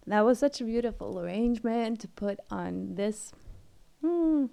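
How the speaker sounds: shaky, moved voice. Real, not acted. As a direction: voice shaking with emotion. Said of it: "my voice is shaking".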